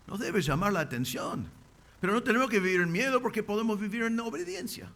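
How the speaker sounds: ordinary talk, nothing marked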